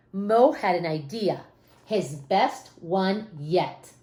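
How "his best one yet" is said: ordinary delivery, nothing marked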